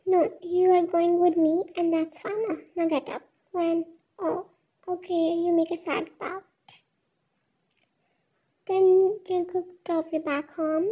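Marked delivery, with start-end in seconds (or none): none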